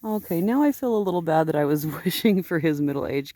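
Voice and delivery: ordinary delivery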